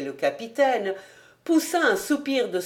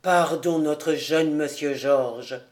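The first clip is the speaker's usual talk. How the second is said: low, slow apology; père charbonnier repentant; 6 semitones lower, slower than usual. A narrator's reading. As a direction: beg pardon in a deep, humble voice. Narrator voices the penitent charcoal burner. (excuse grave et lente)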